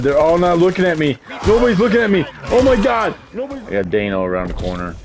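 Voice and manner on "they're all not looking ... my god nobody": loud, high panic, climbing to a yell; panicked yelling. Real, not acted. Gamer panicking as they look at him, panicked yelling. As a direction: yell it higher and louder as panic builds. Panics in game as attackers appear.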